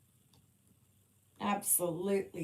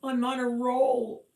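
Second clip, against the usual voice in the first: higher, much louder call; louder exclamation; 7 semitones higher, 11 dB louder than usual. Real, not acted. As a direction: belt it out, falling at the end. Repeats it, calling out louder.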